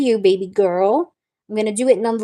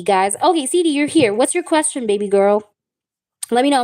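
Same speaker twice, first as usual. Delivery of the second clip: ordinary talk, nothing marked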